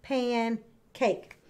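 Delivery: pancake with a clear break after pan; two separated beats for pancake; broken into syllables. Shown as a demonstration. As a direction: say pancake as pan, pause, cake. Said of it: "That's where it breaks".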